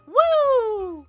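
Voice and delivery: loud, high-pitched "woo!"; celebratory whoop. Real, not acted. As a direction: let out a big celebratory whoop. Cheers a subscriber milestone.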